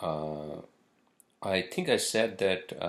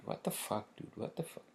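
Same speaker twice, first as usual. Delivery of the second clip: muttered whisper; 12 dB quieter than usual. Real, not acted. Muttered disbelief.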